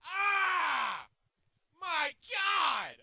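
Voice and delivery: high, loud yell; real exasperated outburst; exasperated scream. Real, not acted. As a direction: shout out in sheer frustration. Screaming at the game.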